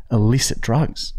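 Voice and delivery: normal talking voice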